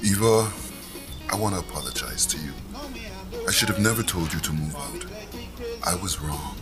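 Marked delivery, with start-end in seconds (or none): 1.3-6.6 soft, low, sincere apology. Acted. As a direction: apologize gently from the heart. Father apologizes to daughter.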